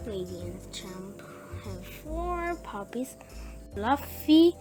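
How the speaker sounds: usual speaking voice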